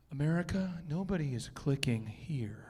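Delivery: soft, slow, near-whispered politician; Ben Carson. Acted. Presenter mimicking a candidate calmly addressing America, hushed and slow. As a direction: speak in hushed, measured pauses. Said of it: "This is me being like Ben Carson".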